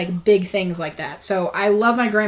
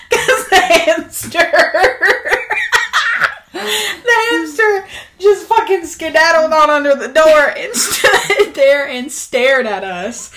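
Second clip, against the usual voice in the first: high, loud, breaking into laughter; laughing while talking; 6 semitones higher, 5 dB louder, slower than usual. Real, not acted. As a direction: talk through giggles. Laughing while telling hamster escape.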